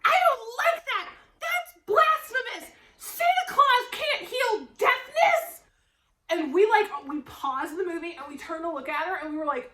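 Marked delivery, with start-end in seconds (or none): 0.0-5.6 high, loud, slow mock outrage; mock yell. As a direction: imitate mom yelling at the screen, hammed up. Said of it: "starts yelling".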